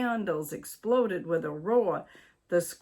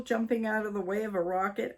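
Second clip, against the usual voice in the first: plain reading voice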